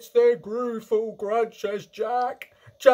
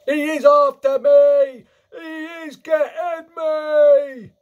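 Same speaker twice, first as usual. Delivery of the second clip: slow, very loud, high wail; screaming; 12 dB louder, slower than usual. A narrator's reading. Giant chased in his nightmare, terrified scream. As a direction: scream it like you are being hunted. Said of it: "screamed the flesh lump eater".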